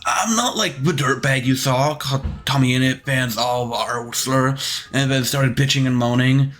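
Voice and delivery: whiny fake-nice self-praise; mocking Dream. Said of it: "this Dream being like".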